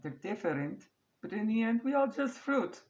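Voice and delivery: high, loud mock Greek dad voice; hammed-up Greek accent, high and loud. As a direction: ham up a Greek father's toast. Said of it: "My terrible Greek accent there".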